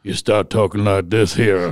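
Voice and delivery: slow, mock Southern accent; lightly drawled Southern imitation. Host teases the guest with a slow mock Southern accent. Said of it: "I was imitating your Southern accent".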